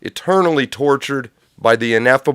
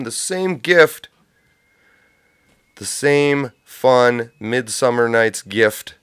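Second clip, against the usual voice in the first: drawn out; slower than usual. Acted. Savoring the gift.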